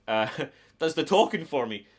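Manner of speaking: ordinary talk, nothing marked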